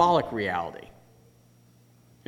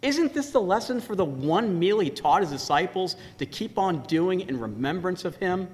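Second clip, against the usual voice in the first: normal talking voice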